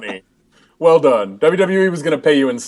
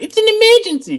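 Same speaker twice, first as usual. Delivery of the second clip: much higher cartoon kid voice; Simpsons kid voice. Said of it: "way to do an accent that approaches his".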